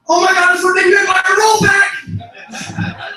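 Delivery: high, loud, drawn-out panic; panicked shout. Acted. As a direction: shriek it as if your car is rolling backwards. Friend panics as car rolls back.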